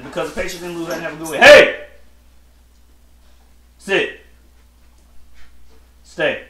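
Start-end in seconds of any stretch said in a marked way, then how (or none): 1.4-1.9 sudden loud shout, pitched high; real abrupt yell; sharp command. Real, not acted. Breaks off to scold a pet. 3.8-6.4 single-word orders to a pet; short pet-training command; firm command. Real, not acted.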